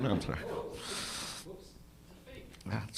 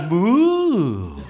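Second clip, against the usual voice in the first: long drawn-out cow moo; comic impression of a mooing cow; Tomo the cow. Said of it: "my impression of Tomo the cow".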